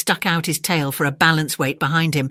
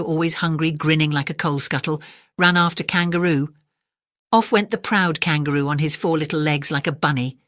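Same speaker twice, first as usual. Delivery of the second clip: ordinary delivery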